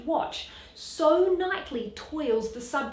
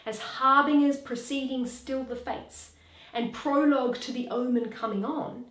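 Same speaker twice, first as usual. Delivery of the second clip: ordinary delivery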